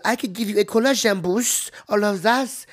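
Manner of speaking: high, badly faked French accent. Receptionist offering collagen boost, fake French accent. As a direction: put on a mock French accent. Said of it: "like, French accent".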